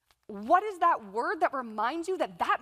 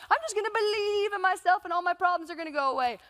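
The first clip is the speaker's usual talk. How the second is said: higher, sing-song mocking; sarcastic mockery; 5 semitones higher than usual. Skeptic mocking the speaker, higher sing-song. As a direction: build the mockery further. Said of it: "some of you are sitting here thinking".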